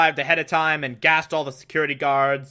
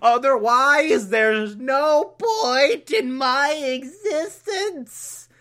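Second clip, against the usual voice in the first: much higher, slow, stretched-out desperate wail; manic Riddler; 7 semitones higher, much slower than usual. Retold or imitated. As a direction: melodramatic villain begging for his purpose. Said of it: "Riddler's like".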